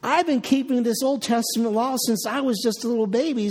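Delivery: normal talking voice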